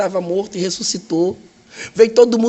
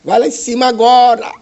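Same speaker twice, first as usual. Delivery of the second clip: higher, louder, slow proclamation; excited announcement; 7 semitones higher, 5 dB louder, slower than usual. Retold or imitated. Nurse told to fetch the director, excited proclamation. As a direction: proclaim the miracle, drawn out.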